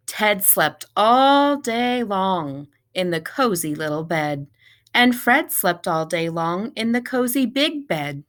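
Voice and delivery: ordinary delivery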